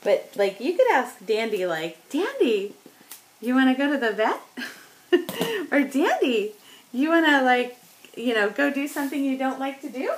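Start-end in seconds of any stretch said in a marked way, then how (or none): 2.1-4.4 high, bouncy rising question to a dog; eager question tune. Retold or imitated. Asking the old dog Dandy, upbeat rising inflection. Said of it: "did the inflection at the end". 5.8-10.1 high, bouncy rising question to a dog; eager question tune. Retold or imitated. Asking the old dog Dandy, upbeat rising inflection. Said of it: "did the inflection at the end".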